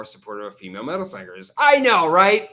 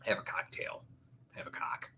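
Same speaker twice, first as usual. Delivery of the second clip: whispered; 3 semitones lower, 12 dB quieter than usual. Acted. Whispered joke aside.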